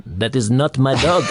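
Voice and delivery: the dog owner's line in a put-on accent; Clouseau accent. S0 does the accent on request for the joke. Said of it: "You gotta do the accent".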